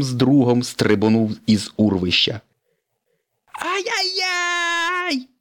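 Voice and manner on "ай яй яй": high, slow cartoon wail; Lightning launched over the canyon, scared; frightened yell. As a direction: wail while flying through the air. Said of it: "закричав Блискавка".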